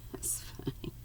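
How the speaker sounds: real amused whisper with a laugh; whispered aside. Real, not acted. Reader's own whispered aside.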